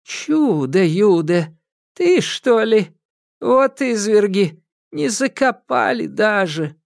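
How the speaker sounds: put-on high, slow old witch voice; grieving old witch, drawn out; Баба Яга. Яга горюет над черепом. (скорбный голос старой ведьмы)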